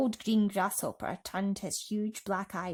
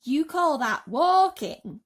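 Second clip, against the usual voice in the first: louder, slower scoff; mock indignant cry; cried; 7 semitones higher, 9 dB louder, slower than usual. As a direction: centipede scoffing at the worm. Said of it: "cried the centipede".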